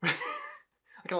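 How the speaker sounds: ordinary talk, nothing marked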